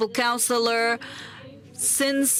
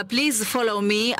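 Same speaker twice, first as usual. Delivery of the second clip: ordinary delivery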